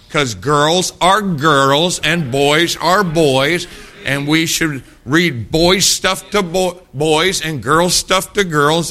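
preacher pounding a simple truth; emphatic point. Real, not acted. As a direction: drive the point home slowly.

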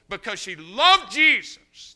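high, loud and slow; slow preaching shout. Real, not acted. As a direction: drag out each word as a shout. Sermon climax on Jesus.

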